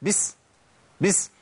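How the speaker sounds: a quick normal بس to a cat; shooing a cat. Shown as a demonstration. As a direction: shoo a cat with بس. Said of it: "بقولها إيه".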